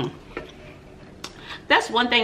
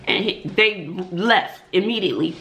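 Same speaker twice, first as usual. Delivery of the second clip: ordinary talk, nothing marked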